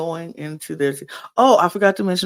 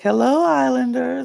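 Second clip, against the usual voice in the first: higher, louder retold hello to a group; greeting call; 9 semitones higher, 5 dB louder than usual. Host greets the Islanders from the balcony, calling out.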